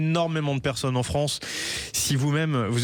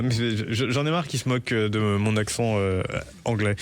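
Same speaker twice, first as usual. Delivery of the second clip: ordinary delivery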